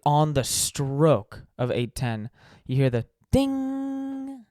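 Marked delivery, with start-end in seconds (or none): 2.6-4.4 high, slow sung ding; bell ding. Retold or imitated. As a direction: ring out the ding.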